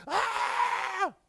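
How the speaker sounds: piercing high, loud screech; toddler screech. Acted. Toddler erupts in the toy store, screeching. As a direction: screech at the top of your lungs. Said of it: "starts screeching at the top of his lungs".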